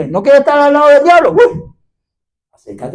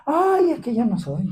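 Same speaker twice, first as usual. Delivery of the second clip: mock reluctant excuse; 10 dB quieter than usual. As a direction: imitate someone wriggling out of preaching. Mimics believer declining to preach.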